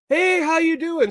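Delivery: mock hailing call; imitated yell. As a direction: mimic someone calling across a restaurant, way up high. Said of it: "yelled out".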